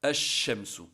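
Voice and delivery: "ash-shamsu" with no L and a long doubled SH; lam silent, shadda. Shown as a demonstration. Teacher giving the shamsiya example, doubled SH in "ash-shamsu". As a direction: say "ash-shamsu", holding the SH in place of the L. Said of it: "on ne prononcera pas le lam".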